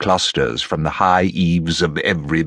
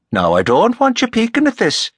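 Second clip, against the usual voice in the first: high-pitched, playful Irish warning; genial Rafferty. Acted. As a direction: warn them off with a folksy lilt. Voices Rafferty giving instructions.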